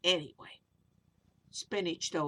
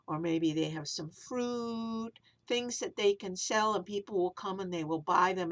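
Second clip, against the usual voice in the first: ordinary delivery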